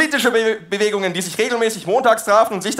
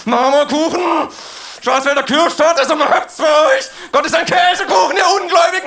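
yelling; 6 semitones higher than usual. Acted. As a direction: bellow it from the back. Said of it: "Da brüllt ein Dritter von hinten".

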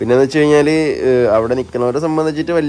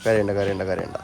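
fading softer and lower into a hush; hushing softly; 4 semitones lower, 6 dB quieter than usual. Real, not acted. Hushing the crying baby.

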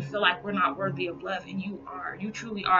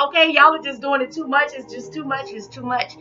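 overwhelmed; 8 semitones higher, 6 dB louder than usual. Retold or imitated. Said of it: "I'm like".

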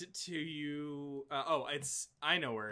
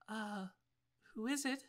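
put-on high, hushed little girl's voice; soft voice; 8 semitones higher, 6 dB quieter than usual. Young girl answers from behind the gate, soft voice. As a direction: answer softly, pitched well up, as a young girl. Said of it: "you hear a sort of soft voice".

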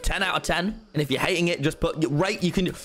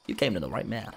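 ordinary talk

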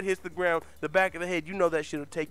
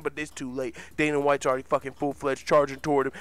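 usual speaking voice

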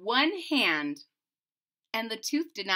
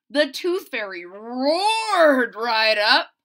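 slow, louder storytelling; dramatic roar; 7 dB louder, slower than usual. A narrator's reading. Narrator telling kids the fairy arrives, dramatic. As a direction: stretch out the roar.

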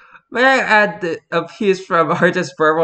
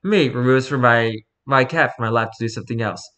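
normal talking voice

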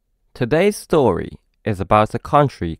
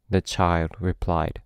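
ordinary delivery, nothing marked

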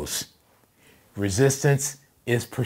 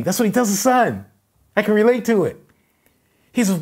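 loud, high, shouted preaching; fired-up preaching; 12 semitones higher, 7 dB louder than usual. Real, not acted. Pastor relating to the yelling dad, fired-up shout. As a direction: preach it at full voice.

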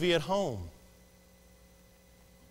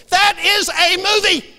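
high, loud, slow incredulous yell; mock outrage at gullible viewers; exasperated shout; 14 semitones higher, 11 dB louder than usual. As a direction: bellow it word by word.

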